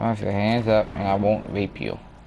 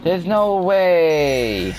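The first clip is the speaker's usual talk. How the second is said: loud, high, drawn-out disbelief; loud slow disbelieving shout; outraged yell; 8 semitones higher, 4 dB louder, much slower than usual. Real, not acted. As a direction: stretch out the protest. Getting killed, outraged.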